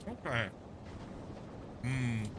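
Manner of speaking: talking with mouth full; words round a mouthful of sandwich; mouth full of peanut butter. Real, not acted. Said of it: "Mouthful of peanut butter sandwich, sorry".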